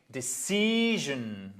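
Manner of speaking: "decision" drawn out on the stressed CI; slow "decision", lean long on the second syllable; stress before -sion. Shown as a demonstration. Teacher showing where stress falls, long stressed CI in "decision". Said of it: "l'accent tonique, juste avant".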